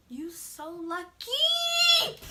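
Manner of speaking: slow drawn-out threat; menacing sass. Retold or imitated. Staring down the girl who kicked, slow threat. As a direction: savor the warning slowly. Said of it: "I was staring at her like".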